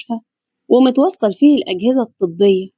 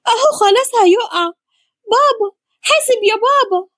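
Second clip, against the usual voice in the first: very high, panicked warning cry; screaming for father; 11 semitones higher than usual. A narrator's reading. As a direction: cry out in alarm, pitch right at the top. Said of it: "وصرخت بصوت أعلى باسم باباها".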